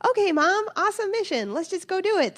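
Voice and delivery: put-on high, loud excited child voice; eager kids. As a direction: chirp it like thrilled four-year-olds. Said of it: "They just were like".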